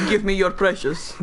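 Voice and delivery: mock action-star Smeagol; Arnold Schwarzenegger. Action star demanding the precious, Austrian accent. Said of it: "Smeagol from The Lord of the Rings".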